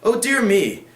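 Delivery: a baronet protesting at bad taste; remonstrating. Sir Leicester objecting to the grim topic, mildly affronted. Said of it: "remonstrates Sir Leicester".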